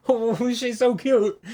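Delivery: slow, high gushing coo; doting dad. Retold or imitated. Embarrassed dad gushing over his daughter in a high slow coo. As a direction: coo like a smitten father. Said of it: "knew that I was like".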